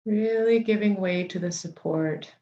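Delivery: unhurried meditation guide, long pauses between phrases; guided meditation. Real, not acted. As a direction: settle listeners gently into the body, leaving space for each breath.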